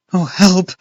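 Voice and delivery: high, loud cry for help; frightened cry. A narrator's reading. Boy cries out as a bat brushes him. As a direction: cry out in fear. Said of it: "I cried".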